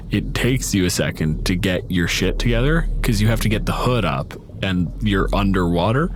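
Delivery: ordinary talk, nothing marked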